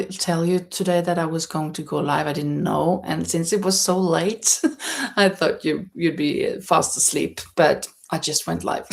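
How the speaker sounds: ordinary talk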